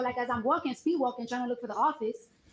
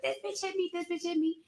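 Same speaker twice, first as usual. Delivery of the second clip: imitated wilding out; 7 semitones higher than usual. Guard accusing her before the receptionist, imitated wilding out. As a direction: mimic her hysterical outcry on a high pitch. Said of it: "she just started wilding out".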